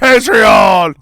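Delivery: monster truck ad shout. Pretend. Booming rally ad.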